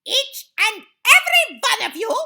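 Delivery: high, very loud command; thundered. A narrator's reading. Grand High Witch commanding her witches, thundered. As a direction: thunder the order at each listener. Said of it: "thundered the Grand High Witch".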